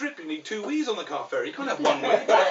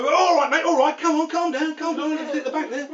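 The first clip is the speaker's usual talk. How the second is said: high, raised protest; urgent calling out; 9 semitones higher, 5 dB louder than usual. Acted. Ferry marshal telling a driver to calm down, urgent. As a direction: call the driver off in alarm.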